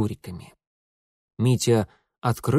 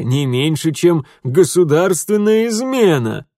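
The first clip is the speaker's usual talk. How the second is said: loud, raised, emphatic disbelief; booming mock astonishment; incredulous scoffing; 5 semitones higher, 7 dB louder than usual. A narrator's reading. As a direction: ridicule the idea at full voice. Father scoffs loudly after laughing.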